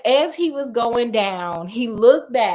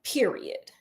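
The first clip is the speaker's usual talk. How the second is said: unvoiced breathy Period; whispered period; 7 dB quieter than usual. Insisting viewers visit the falls, whispered Period. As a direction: whisper Period again, a little firmer. Emphatic sign-off repeated.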